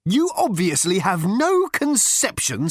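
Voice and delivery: high, slow hauteur; haughty. A narrator's reading. Management consultant putting Ford down, haughty. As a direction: look down on him with every word. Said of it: "abandoning testiness in favour of good old-fashioned hauteur".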